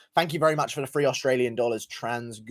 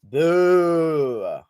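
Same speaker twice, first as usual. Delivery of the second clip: a long, loud booing call to the chat; 2 semitones higher, 5 dB louder than usual. Real, not acted. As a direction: boo him loudly like a crowd. Rallying chat to boo Noah.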